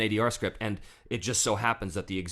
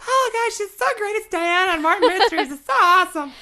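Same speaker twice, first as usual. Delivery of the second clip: very high, loud squealing fan impression; gushing fan; 9 dB louder than usual. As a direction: gush like an overexcited fan, way up in pitch. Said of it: "a lot of people who all say".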